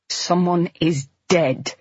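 slow, deliberate and even; patient forces herself to speak slowly and clearly. Acted. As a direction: hold back sobs, word by word. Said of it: "I say very clearly".

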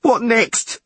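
high, loud demand; frantic yell. A narrator's reading. Skipper demanding what comes next, high frantic yell. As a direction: yell it in panic. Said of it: "yelled Podlorak".

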